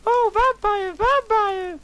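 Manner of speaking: very high, loud falsetto cry; mock scream. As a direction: wail the name like a campy heroine. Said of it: "I screamed".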